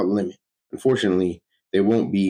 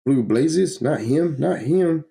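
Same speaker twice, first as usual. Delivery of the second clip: higher and higher, horrified denial; rising horror; 8 semitones higher, slower than usual. Acted. Hero watching the Flash vanish, horror climbing higher. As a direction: build from shock to pleading disbelief.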